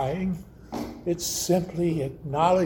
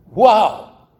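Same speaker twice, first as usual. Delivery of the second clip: a much louder, sharper angry "Wow"; 3 semitones higher, 10 dB louder than usual. Retold or imitated. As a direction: bark "Wow" out in angry shock. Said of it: "with an angry look".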